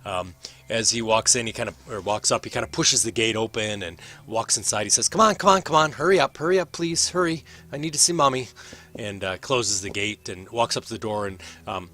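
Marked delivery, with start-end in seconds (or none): 5.1-8.5 high, loud, rushed pleading; impatient young lord. Acted. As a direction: hurry them along, begging for Mommy. Young lord urgently begs to enter.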